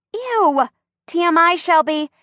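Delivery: high-pitched squeamish protest; acted disgust, pitch jumping up; grossed out. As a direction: recoil at too much information. Grossed out by friend's oversharing.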